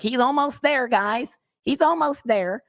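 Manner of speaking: very high, put-on coaxing voice; mocking almost-there crowd. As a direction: whine it like a patronising cheerleader. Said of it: "always like".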